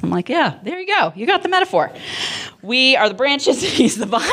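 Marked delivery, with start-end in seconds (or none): 0.3-1.9 put-on very high-pitched praise to a small child; encouraging mom. Mother cheering on her little son. 2.6-4.2 high, cutesy imitation of a little kid; three-year-old voice. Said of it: "in his little three-year-old voice".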